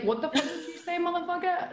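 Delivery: high-pitched mock outrage with a laugh in it; retold anger. Retelling the confrontation over Natty, laughing mock anger. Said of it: "I was, like, mad".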